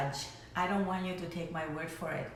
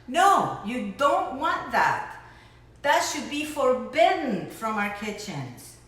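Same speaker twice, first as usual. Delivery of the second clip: indignant; 3 semitones higher, 6 dB louder, slower than usual. Real, not acted. Outraged plea to viewers.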